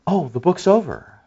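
gasp, then soft startled remark; mock surprise. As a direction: feign shock at the empty page.

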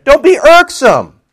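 high, drawn-out mock scolding; playful exclamation. Shown as a demonstration. Preacher playing with the word irksome, mock scolding. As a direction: pitch "Don't be irksome" way up and stretch it. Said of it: "I could do a lot with that word".

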